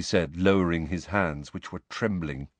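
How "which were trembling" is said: plain reading voice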